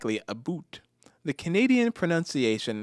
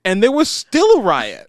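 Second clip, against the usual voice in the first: loud, high disbelief; incredulous; 8 semitones higher, 12 dB louder than usual. Real, not acted. Telling a co-host winners still rioted, loud incredulous exclaim.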